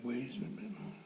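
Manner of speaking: ordinary talk, nothing marked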